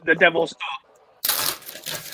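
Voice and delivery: choppy bad connection; breaking up on the call; cutting out. Real, not acted. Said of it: "you're cutting out".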